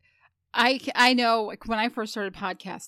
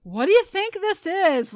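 higher, drawn-out mock yell of an inner critic; imitated scolding, loud and slow; mock scream; 5 semitones higher, 4 dB louder, much slower than usual. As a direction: screech at yourself like a scornful inner critic. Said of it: "I could hear her screaming at me".

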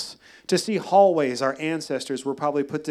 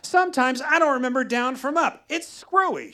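mom's voice; 9 semitones higher, 4 dB louder than usual. Retold or imitated. Mom quoted, chemo brain confusion.

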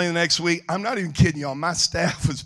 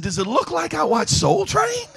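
high-pitched indignant comeback; incredulous retort; 12 semitones higher than usual. Retold or imitated. Preacher retorts to lady on the plane, incredulous. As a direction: throw it back at the lady, incredulous.

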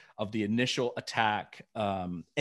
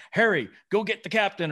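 off-camera order; 9 semitones higher, 4 dB louder than usual. Retold or imitated. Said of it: "Chakotay's off-camera line".

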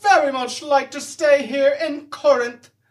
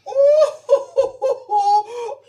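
mocking cackle; 10 semitones higher than usual. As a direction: laugh in his face. Mocking laugh.

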